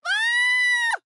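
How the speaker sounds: very high, loud shriek; terrified scream. Acted. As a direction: let out a long shriek of fright. Frightened by the spider clown.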